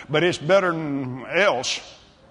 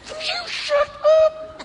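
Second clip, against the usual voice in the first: very high, drawn-out comic comeback; hammed-up high-pitched rebuff; mock retort; slower than usual. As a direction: squeal it slowly at the tempter. Acted retort to the devil.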